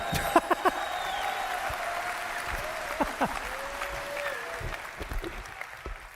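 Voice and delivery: high breathless nervous giggle. Real, not acted. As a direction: giggle from stage nerves. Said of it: "I'm nervous".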